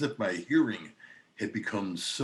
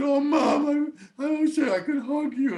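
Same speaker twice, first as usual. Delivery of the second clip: a much higher, louder tearful wish; imitated crying; 7 dB louder than usual. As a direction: ache to hug her through the phone. Crying to mother on phone.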